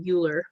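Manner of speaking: Euler read as YOO-ler; spelling pronunciation. Shown as a demonstration. Teacher contrasting spelling with sound, Euler misread as YOO-ler. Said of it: "even though it's spelled Euler".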